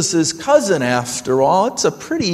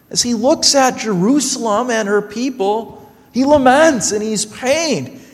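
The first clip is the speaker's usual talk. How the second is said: ordinary delivery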